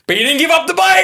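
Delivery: high, loud retold punchline; mock yelled comeback. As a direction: holler it as a teasing comeback.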